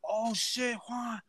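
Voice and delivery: lightly voiced boss, caught off guard; surprised boss. Retelling how his old boss suddenly recognized him, mildly surprised. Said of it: "He like".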